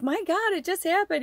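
a light aunt voice, reassuring; aunt's voice. Retold or imitated. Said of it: "like, like".

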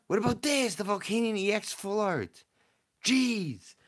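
high, loud and amazed at the pull; thrilled. Real, not acted. Streamer gasping at a rare card pull, high and thrilled. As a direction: let the voice jump up with delight.

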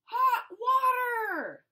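loud, high, slow yell; pained yell. Acted. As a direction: yell in burning pain. Scalded by hot water.

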